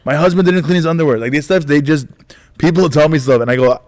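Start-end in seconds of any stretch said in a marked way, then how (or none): 0.1-1.2 high, animated mock grievance; imitated fan complaint. Fan messaging comedian about spouse, mock complaining. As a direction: tattle on a spouse in a higher voice. Imitates fans' roast requests.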